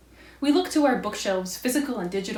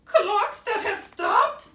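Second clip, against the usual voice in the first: very high, slow mock wail; crying Owl; 12 semitones higher, 3 dB louder, much slower than usual. A narrator's reading. Owl cries over sad things, very high mock wail. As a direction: stretch it into a melodramatic squeal of grief. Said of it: "Owl was crying".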